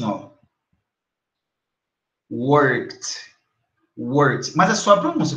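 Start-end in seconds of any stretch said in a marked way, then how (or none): 2.3-4.6 "Worked" in one syllable ending in a crisp T. Shown as a demonstration. Teacher models "Worked" for learners, ED said as T. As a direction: say "Worked" as work-t. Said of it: "é como se no lugar do E D tivesse um T".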